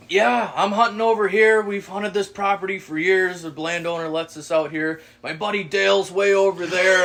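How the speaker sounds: a lightly voiced know-it-all; loud hunter guy. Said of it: "he's like".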